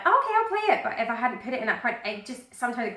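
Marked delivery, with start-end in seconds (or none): 0.0-0.8 giving in. Retold or imitated. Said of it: "I'm like".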